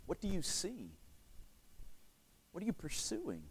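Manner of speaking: very soft, with long pauses; hushed, probing appeal to listeners; quiet searching questions. Real, not acted. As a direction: ask it gently, leaving room to reflect. Preacher's searching questions to congregation.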